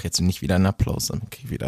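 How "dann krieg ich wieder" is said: breathy whispered aside; whispering. Real, not acted. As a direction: drop to a whisper mid-joke. Joking about getting edited.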